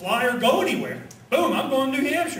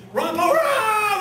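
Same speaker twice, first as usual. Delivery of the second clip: rally cheer; 11 semitones higher, 4 dB louder, much slower than usual. Retold or imitated. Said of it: "We just kept screaming".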